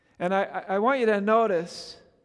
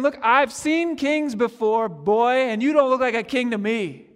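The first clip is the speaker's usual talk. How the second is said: mocking; 3 semitones higher, 5 dB louder than usual. Pilate sizing up the prisoner, louder scornful sneer. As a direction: look him over with contempt. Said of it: "he's made a mocking statement".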